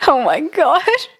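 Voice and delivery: slow, amused exasperation; laughing oh my gosh. Real, not acted. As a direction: laugh it out, slowly. After laughing at a friend's teasing.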